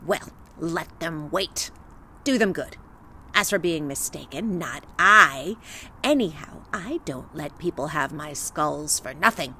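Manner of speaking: put-on low, menacing old witch. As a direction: threaten like a gloating hag. Witch threatening Vasilisa.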